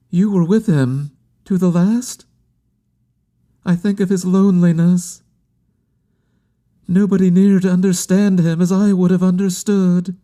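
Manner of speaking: high-pitched, plaintive questioning; grieving woman. A narrator's reading. Woman asking about his last moments, high and plaintive. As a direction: dwell on his loneliness in a high, sorrowful voice.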